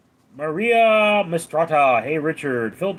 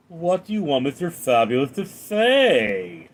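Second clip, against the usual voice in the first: Mister Fabulous. Acted. Streamer answering a viewer's impression request, hammed-up character voice. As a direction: ham it up, swelling on the last word. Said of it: "can you do the Mister Fabulous impression".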